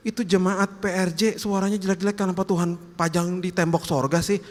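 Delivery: high-pitched, whining objection; complaining angel. Acted. Angel asking God why bad singing is displayed, complaining.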